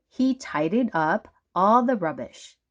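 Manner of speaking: plain reading voice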